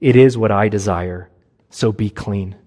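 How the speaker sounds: deep, settled declaration; authoritative Jesus. Acted. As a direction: speak with calm command. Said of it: "Now Jesus responds".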